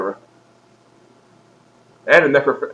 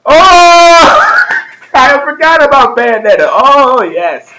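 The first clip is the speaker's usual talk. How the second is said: loud, high drawn-out "oh" breaking into laughter; gleeful high exclamation; delighted realization; 10 semitones higher, 5 dB louder, much slower than usual. Real, not acted. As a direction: burst out as if just reminded, then settle. Suddenly reminded of forgotten game.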